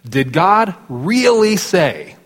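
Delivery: "Did God really say?" slow, high, weight on "really"; stress on really. Shown as a demonstration. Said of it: "The underlines are points of emphasis here".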